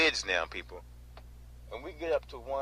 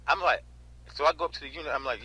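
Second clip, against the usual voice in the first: ordinary talk, nothing marked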